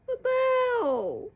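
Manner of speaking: high, fast, dismayed; upset exclaim. Real, not acted. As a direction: blurt out the dismay. Upset about a sad book.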